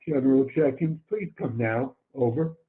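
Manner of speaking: ordinary delivery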